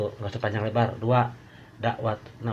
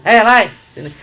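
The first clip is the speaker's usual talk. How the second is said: much higher and louder, shouting across; calling out; 11 semitones higher, 15 dB louder, faster than usual. Acted. Friend calling someone named alay over, loud higher shout. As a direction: call a buddy over by nickname.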